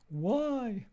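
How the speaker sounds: squeaky emphatic question; high exclaimed why. Acted. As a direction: pop up high and loud on why. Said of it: "pragmatics asks".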